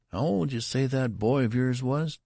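high-pitched, inquisitive store clerk; curious clerk. A narrator's reading. Clerk asks father about the boy's age, high curious demand. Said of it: "demanded the clerk curiously".

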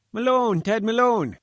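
high, loud plaintive calling out; plaintive bleat. Acted. Fat little reporter calls plaintively to Malone, high and loud. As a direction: bleat the name up high, pleading to be noticed. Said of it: "a plaintive bleat".

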